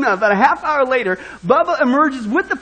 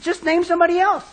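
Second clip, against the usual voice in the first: higher-pitched mock plea; imploring; 7 semitones higher than usual. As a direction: lightly implore the boss, acting it out. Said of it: "who again implores him".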